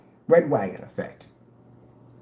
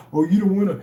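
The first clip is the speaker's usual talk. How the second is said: mock tough guy; 6 semitones higher, 5 dB louder, faster than usual. Mocking a critic's threat.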